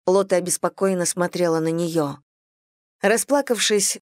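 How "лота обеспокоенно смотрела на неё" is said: usual speaking voice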